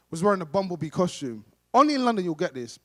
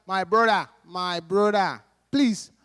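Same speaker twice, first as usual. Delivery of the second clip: polite Nigerian tourist asking the way; imitated Nigerian English; Nigerian accent. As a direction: plead in a put-on Nigerian accent. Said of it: "this Nigerian tourist".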